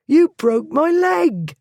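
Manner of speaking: high, loud wailing complaint; pained yell. A narrator's reading. Thief wailing at Blackwood after being dropped, high and loud. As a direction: howl about the leg.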